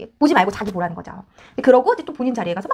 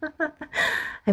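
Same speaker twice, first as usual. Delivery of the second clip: short laugh; 5 semitones higher, 8 dB quieter than usual. Real, not acted. Laughs after mock scolding.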